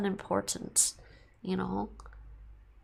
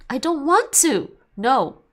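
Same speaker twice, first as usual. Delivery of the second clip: firm loud no; 6 semitones higher, 8 dB louder, slower than usual. Acted. Acting out firm refusal.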